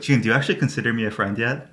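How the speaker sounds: normal talking voice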